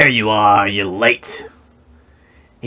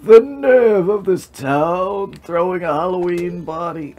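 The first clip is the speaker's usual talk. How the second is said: character voice; 8 semitones higher than usual. Acted. Voicing a game character.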